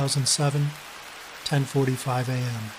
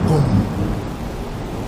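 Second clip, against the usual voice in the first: boom; 5 dB louder than usual. A narrator's reading. Thunder sound in story.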